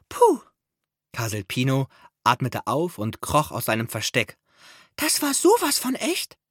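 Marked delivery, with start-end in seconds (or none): 0.1-0.4 very high little sigh of relief; squeaky relieved puff. A narrator's reading. Mouse relieved after fright. 5.0-6.4 very high squeaky marvelling; awed little mouse. A narrator's reading.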